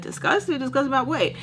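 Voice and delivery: high-pitched offended disbelief; incredulous echo. Retold or imitated. Boyfriend reacting to girlfriend being disgusted, high and incredulous. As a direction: throw the word back, voice shooting up.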